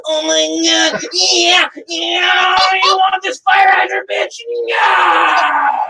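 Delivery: high, full-out mock yelling; hammed-up actor impression. Host imitates a performer's funny videos, hammed-up shouting. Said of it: "he has the funniest porn videos".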